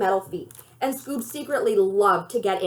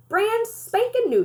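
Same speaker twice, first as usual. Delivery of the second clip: proud grandma; 10 semitones higher than usual. Acted. Grandma showing off RV.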